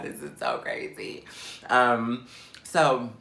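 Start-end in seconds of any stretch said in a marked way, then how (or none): 0.0-1.2 breathy whisper of disbelief. Retold or imitated. Whispering to herself in disbelief about being homeless. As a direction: whisper it in shock. Stunned at her own situation.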